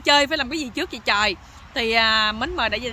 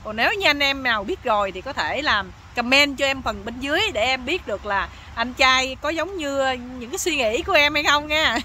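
ordinary talk, nothing marked

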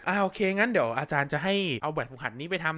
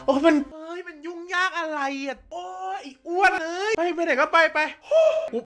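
put-on high, shrill complaining girl voice; whining girl; 10 semitones higher than usual. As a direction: throw a high whiny tantrum. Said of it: "แม่งก็โวยวายใส่กู".

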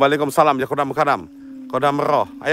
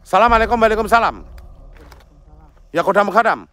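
mock incantation; 5 semitones higher than usual. Shown as a demonstration. Host demonstrating the mantra for Dimas, a chanted mock incantation. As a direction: show him how to chant the summoning. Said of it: "tantangi ngene".

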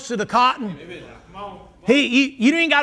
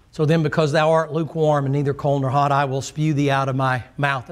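lower, solemn King James reading; scripture reading; 7 semitones lower than usual. Acted. Pastor reads Revelation to the congregation, solemn and measured. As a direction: read the verses with weight and gravity.

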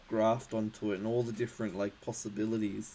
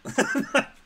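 squealing laugh, much higher; loud giggle breaking out; high burst of laughter; 9 dB louder than usual. Real, not acted. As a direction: crack up at your own bad accent. Laughing at own accent attempt.

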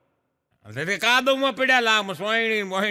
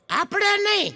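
much higher little schoolboy voice; child voice; 13 semitones higher than usual. Retold or imitated. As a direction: pipe up like a cheeky kid. Schoolboy Pabho answers teacher. (શિક્ષકને જવાબ આપતો નાનો છોકરો, ઊંચા બાળ અવાજે)

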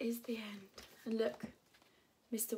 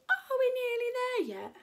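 put-on pestering child; 13 semitones higher, 7 dB louder than usual. Preston asking on the journey, loud pestering child. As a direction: nag like an impatient child on a long trip.

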